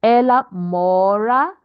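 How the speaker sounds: slow, loud MO in 'mora', rising end; stress on second-last syllable. Shown as a demonstration. Teacher modelling the stress rule, 'mora' stressed on MO. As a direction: stretch the MO of 'Ela mora'. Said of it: "second to the last syllable will be stressed".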